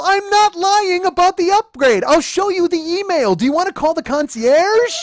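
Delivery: high, loud, outraged denial; indignant shouting. Retold or imitated. Boyfriend denying he lied about the upgrade, indignant yelling. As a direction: shout every phrase at full pitch.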